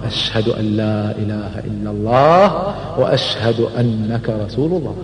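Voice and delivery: reverent, quiet shahada; solemn declaration. Retold or imitated. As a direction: say it softly and humbly. Umar declares his faith.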